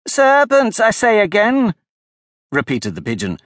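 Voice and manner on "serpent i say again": plain reading voice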